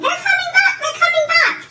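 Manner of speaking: high, loud alarm cry; screaming. A narrator's reading. Bird shrieking a warning that they're coming back, screaming.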